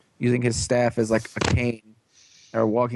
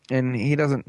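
ordinary delivery

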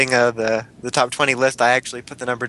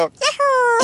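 very high squeaky Mario-style whoop; high Yahoo cry. Retold or imitated. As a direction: squeal it as you jump. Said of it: "jump up and go".

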